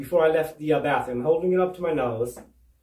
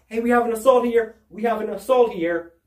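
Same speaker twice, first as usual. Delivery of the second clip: hollering; 6 semitones higher than usual. Retold or imitated. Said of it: "she was hollering out".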